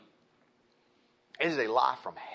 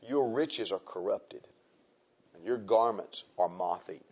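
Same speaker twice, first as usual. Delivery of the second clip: measured King James reading; scripture reading; 4 semitones lower than usual. Acted. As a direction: read it like a solemn warning from the pulpit. Reads scripture.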